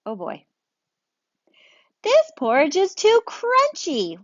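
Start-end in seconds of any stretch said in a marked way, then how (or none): 2.0-4.2 much higher, slow fussy bear; a picky bear crunching; picky bear, drawn out and high. Acted. Bear voiced in read-aloud story.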